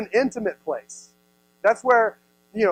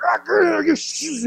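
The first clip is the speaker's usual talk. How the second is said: mock struggle growl; 8 semitones higher, slower than usual. Pastor hamming up a kidnapper's struggle. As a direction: grunt like wrestling someone into the trunk. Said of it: "He's like".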